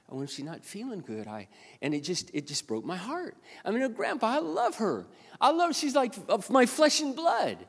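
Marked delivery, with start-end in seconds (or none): 3.6-7.6 high, emphatic outpouring of love; heartfelt, high-pitched grandfatherly gushing; impassioned declaration of love. Retold or imitated. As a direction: gush it out, pitch soaring.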